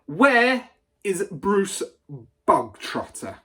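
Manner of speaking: loud, slow, high call across the hall; barking demand. A narrator's reading. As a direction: bellow it at the whole school. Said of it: "the Trunchbull barked suddenly".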